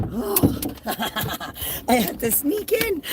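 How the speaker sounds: very high, cooing puppy talk. Real, not acted.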